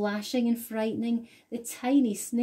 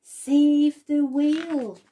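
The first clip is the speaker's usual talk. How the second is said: slow, louder, emphatic plea; urgent message; 7 semitones higher, 10 dB louder, slower than usual. A narrator's reading. The trail's message to the children, urgent. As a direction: proclaim the rescue call. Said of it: "a silvery trail saying".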